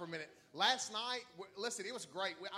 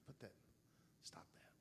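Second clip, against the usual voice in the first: much lower, much softer voice of the Lord; the Lord's voice; 13 semitones lower, 18 dB quieter, faster than usual. Retold or imitated. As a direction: say it gently, as God speaking. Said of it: "the Lord's like".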